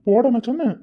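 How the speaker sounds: teasing loud dare to his sweetheart; playful challenge. Acted. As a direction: raise your voice in a teasing dare. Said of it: "என்ன சொன்ன?".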